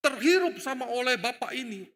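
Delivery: ordinary delivery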